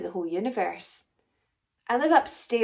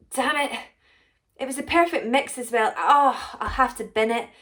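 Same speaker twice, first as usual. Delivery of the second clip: higher and louder, cursing the spoiled batch; frustrated outburst; 5 semitones higher, 5 dB louder than usual. Acted. Potion maker realising her mix is ruined, loud and annoyed. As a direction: snap out the curse, then sigh it off.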